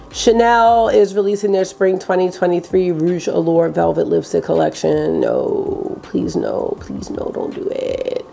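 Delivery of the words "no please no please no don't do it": whispered pleading. Acted. Begging brand not to release.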